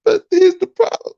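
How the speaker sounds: squeaky falsetto crybaby imitation; hammed-up sobbing protest, sky-high; mock crying. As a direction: whine it very high, making fun of the complainers. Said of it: "cry in that goddamn corner".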